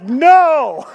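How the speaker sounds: a loud blunt no; mock flat refusal. Refusing the stuck lady, loud and blunt. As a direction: shut her down with one loud word. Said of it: "And we're like".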